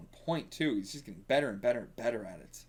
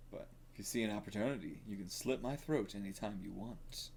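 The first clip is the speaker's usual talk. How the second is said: quiet menace; 4 semitones lower, 11 dB quieter than usual. Acted. Reading a menacing manga line.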